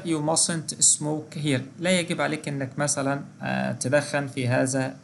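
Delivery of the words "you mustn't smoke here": very slow, word by word warning; teacher reading a model prohibition slowly; slow example read. Shown as a demonstration. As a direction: spell out the warning for students. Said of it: "يعني أقول لك مثلًا كده".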